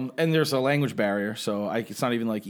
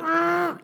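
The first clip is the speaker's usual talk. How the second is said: mock effort growl, pitched way up; high straining grunt. Passenger yanking a stuck arm free, high straining grunt.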